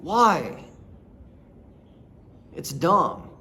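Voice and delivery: ordinary delivery, nothing marked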